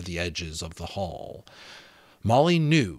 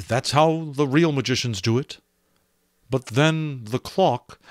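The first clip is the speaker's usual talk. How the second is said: put-on raised, eager young magician; 5 semitones higher, 4 dB louder than usual. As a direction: catch on excitedly.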